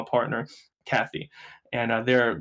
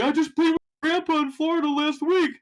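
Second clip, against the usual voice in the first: high put-on old-timer voice; chatty old player bragging; old guys voice; 4 dB louder than usual. Old players tell him about his grandpa, high old-timer voice. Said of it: "you have these old guys".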